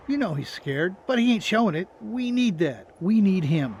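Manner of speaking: impassioned, rising in force; forceful insistence. Acted. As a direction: hammer the need home loudly. Old man argues forcefully with Lori.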